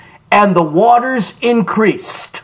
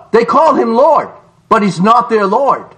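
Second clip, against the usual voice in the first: ordinary delivery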